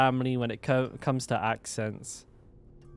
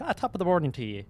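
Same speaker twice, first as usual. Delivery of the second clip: mock Irish accent; 4 semitones higher, much faster than usual. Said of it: "I'm twenty-five percent Irish".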